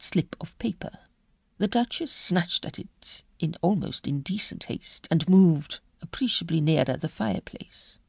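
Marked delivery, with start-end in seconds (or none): none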